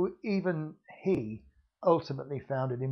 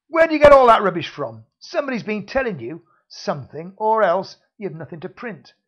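loud, much higher demanding voice; angry interrogation; 5 semitones higher, 11 dB louder than usual. Acted. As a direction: hammer him with questions. Villain interrogates hero.

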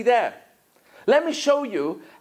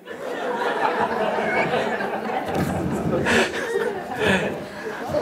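real laughter. Real, not acted. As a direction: laugh in surprise. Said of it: "I'm laughing 'cause I thought you were joking".

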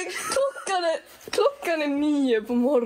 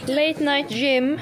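mock announcer; 2 semitones higher, 4 dB louder, much slower than usual. Captioning the clip.

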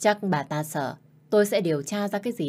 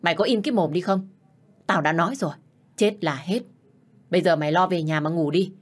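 angry scolding; 4 dB louder than usual. Acted. Stepmother orders her to shut up.

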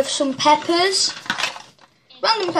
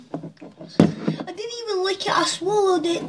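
strained, softer and higher; burning throat; 5 semitones higher, 5 dB quieter than usual. Real, not acted. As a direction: talk through a burning throat. Hot sauce swallowed, burning throat.

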